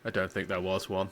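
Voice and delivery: low, quiet, deadpan Russian accent, barely attempted; half-hearted Russian accent. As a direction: mumble it in an unconvincing Russian accent. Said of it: "I really wish I could do a convincing, like, Russian accent".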